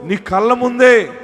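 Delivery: high, loud prophetic proclamation; full-force preaching. Real, not acted. Preacher promising the troubled that justice is coming, full-force proclamation. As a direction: proclaim it at the top of your preaching voice.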